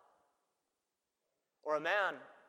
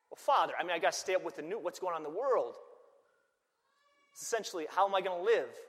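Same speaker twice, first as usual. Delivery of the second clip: defensive protest; 5 semitones higher, 11 dB louder than usual. Retold or imitated. Trucker dad protests to priest.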